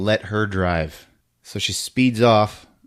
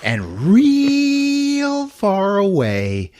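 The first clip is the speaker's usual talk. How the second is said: high, slow, drawn-out "real"; slow rising emphasis on "real"; far-off stretch; 8 semitones higher, much slower than usual. Real, not acted. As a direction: stretch "real" upward to show distance. Showing the distance by voice.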